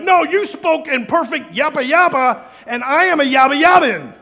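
high, loud, hammed-up insisting; amazed insistence. Listeners to the tongues speaker, amazed insistence. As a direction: insist loudly on the impossible.

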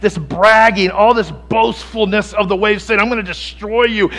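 loud, driving sermon build; impassioned preacher pressing the point; preaching at full force. Acted. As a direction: preach it hard to the congregation.

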